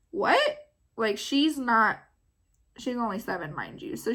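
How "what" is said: high-pitched retold "what?"; puzzled child. Daughter baffled at being rushed, puzzled. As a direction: pitch it up in mild disbelief.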